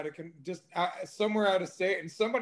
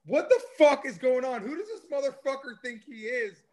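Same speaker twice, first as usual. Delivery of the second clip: angry outburst; 6 semitones higher, 5 dB louder than usual. Retold or imitated. Retelling his angry reaction.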